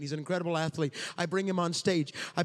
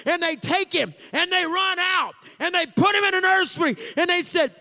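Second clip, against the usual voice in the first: high, loud, roaring sermon cadence; preaching at full force; 11 semitones higher, 8 dB louder than usual. Acted. Preacher thundering the Bible story to his church, full force. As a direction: pound the story out to the congregation.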